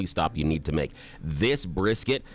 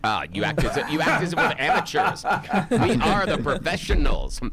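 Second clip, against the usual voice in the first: mock indignant; 8 semitones higher, 4 dB louder than usual. Mock offense at teasing.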